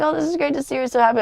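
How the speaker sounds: high, fast, pleased gush; delighted quote. Retold or imitated. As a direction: rattle it off gleefully. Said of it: "Richard at first was like".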